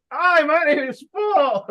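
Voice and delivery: high, loud, drawn-out goofy voice; hammed-up awkward salesman introducing himself; mock a clueless rep, stretched out and high; hunched-over weirdo. Shown as a demonstration. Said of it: "you're hunched over, you're not giving any eye contact".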